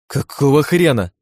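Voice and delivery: slow, high, loud astonishment; drawn-out startled curse, pitch jumping up; surprised swearing. A narrator's reading. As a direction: curse in stunned disbelief. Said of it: "удивлённо выругалась Ирен".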